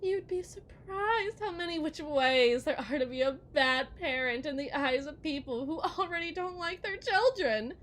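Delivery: normal talking voice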